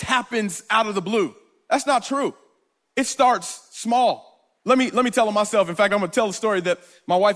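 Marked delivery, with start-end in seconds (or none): 3.0-4.5 drawn-out, weighty statement; preacher slowing to hammer the point; slow emphasis. Real, not acted. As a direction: let each word land slowly.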